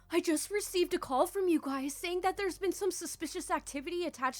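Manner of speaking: high, worried voice on the phone; hammed-up panicky caller in a high pitch; concerned and anxious. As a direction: plead with exaggerated anxiety. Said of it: "highest level of concern and anxiety in my voice".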